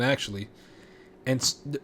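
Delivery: ordinary talk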